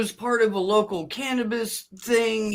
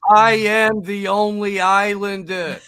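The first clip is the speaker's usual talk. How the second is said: Jersey guy's Islander; 4 dB louder than usual. Pretend. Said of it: "Big Mike from Jersey".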